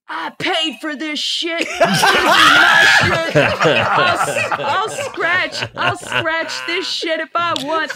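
hammed-up loud possessive rant, words stretched; mock furious shouting; imitated husband yelling. Husband defends scratching the floor he paid for, mock shouting. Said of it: "He's like".

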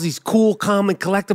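normal talking voice

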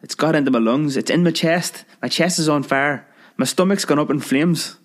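high, choked panic; high spluttering panic. Acted. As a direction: gasp it out like you're poisoned. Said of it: "spluttered the manly lover".

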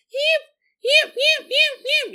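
high frantic yipping imitation; dog yipping. Narrator imitates the scared dog's yelps, high yipping. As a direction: yip like a scared little dog. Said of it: "they hear him go".

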